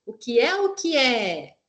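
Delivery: "O que é o que é?" said as a clear, lifted riddle question; question intonation. Shown as a demonstration. Teacher modelling riddle question tone for students, marked questioning tune. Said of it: "explore a entonação da interrogação".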